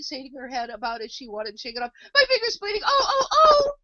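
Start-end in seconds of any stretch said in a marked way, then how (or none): 2.1-3.7 very high, wailing screams; screaming shout. A narrator's reading. The Queen shaking her hand about, screaming. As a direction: shriek it in a panic. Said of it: "shouted the Queen".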